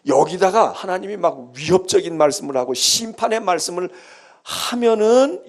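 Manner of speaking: normal talking voice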